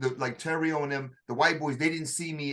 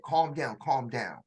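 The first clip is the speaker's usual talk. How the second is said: softer, low and hushed reassurance; soothing calm voice; 5 semitones lower, 4 dB quieter, slower than usual. Retold or imitated. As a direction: settle a panicking soldier gently. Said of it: "spoke to me in a totally different voice".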